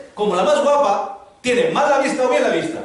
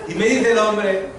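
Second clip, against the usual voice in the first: usual speaking voice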